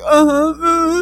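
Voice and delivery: high wordless fake sobbing; imitated blubbering. Kid trying to answer officers, imitated blubbering. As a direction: blubber as if unable to speak. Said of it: "I could not even speak".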